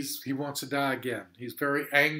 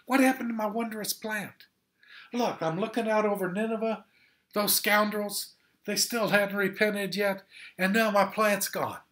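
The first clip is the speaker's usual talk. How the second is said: higher, louder, indignant whining prophet; bitter prophet griping about his lost plant; sulking, self-pitying grievance; Jonah's angry complaint; 6 semitones higher, 3 dB louder than usual. Acted. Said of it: "He's angry with God again".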